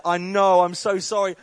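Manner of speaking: loud, laughing apology; loud sheepish apology. Retold or imitated. Caught out by the woman, loud laughing apology. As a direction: blurt sorry with a laugh.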